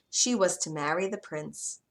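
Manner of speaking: ordinary delivery, nothing marked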